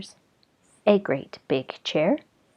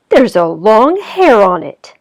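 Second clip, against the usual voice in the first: louder indignant bear; mild bear grumble; 3 semitones higher, 10 dB louder than usual. Acted. Daddy Bear finds a hair, louder. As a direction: grumble it with outrage.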